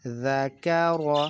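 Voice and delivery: "ذا کا را" slowly blended, each vowel drawn out; slow teaching read-out of "ذا کا را" as linked sounds; joined syllables. Shown as a demonstration. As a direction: join "ذا کا را" into one slow, measured word. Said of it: "جب ہم ان کو join کریں گے".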